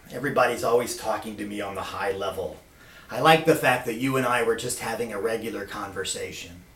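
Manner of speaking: put-on low, subdued old-man voice; Kim Dae-jung. Old president confiding in Lance, low weary voice. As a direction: say it low and wearily after a sigh. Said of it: "And Kim Dae-jung says".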